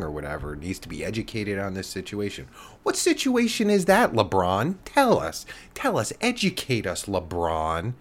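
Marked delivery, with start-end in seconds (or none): 2.8-7.9 mocking challenge. Podcaster loudly taunting LeBron over China comments, mocking. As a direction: taunt LeBron to explain himself.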